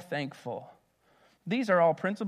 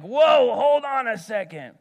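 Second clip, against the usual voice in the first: loud, higher mock-alarmed dad; dad halting everyone; 8 semitones higher, 10 dB louder than usual. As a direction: call out like dad stepping in. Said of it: "dad's like".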